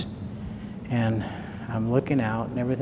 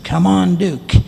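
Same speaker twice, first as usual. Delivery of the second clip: louder, higher call to the dog to run; urgent call to the dog; 5 semitones higher, 7 dB louder than usual. Retold or imitated. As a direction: call the dog in, hurried and alarmed. Calling dog while fleeing creature.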